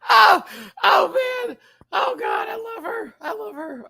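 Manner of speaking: high, tearful cry. Real, not acted. Moved by Alexis, real high tearful cry. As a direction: break into a sob of affection.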